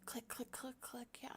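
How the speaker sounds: soft unvoiced whisper. Real, not acted. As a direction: whisper it under your breath. Clicking while working.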